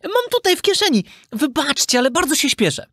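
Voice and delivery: put-on very high child voice; innocent puppet boy. Puppet boy excusing himself to cat, high innocent voice. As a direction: answer politely as a squeaky little boy.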